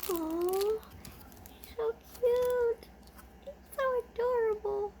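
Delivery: high cooing gush. Real, not acted. Admiring an owl figure gift, high cooing.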